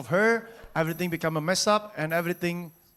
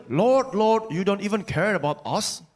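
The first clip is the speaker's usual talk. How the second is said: higher-pitched acted cry; shouted appeal; 4 semitones higher than usual. Disciples shouting to the Lord in the boat, shouted appeal. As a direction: call out in protest, strongest at the start. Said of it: "Then they shouted".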